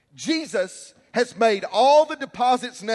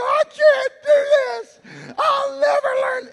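put-on high, loud wailing child; frustrated child crying out; 8 dB louder than usual. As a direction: cry out like a frustrated kid who gives up. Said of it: "I remember getting so frustrated".

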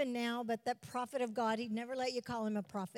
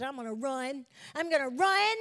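joyful vow; 7 dB louder than usual. Retold or imitated. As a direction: build from eager to jubilant. Daughter's joyful vow, repeated.

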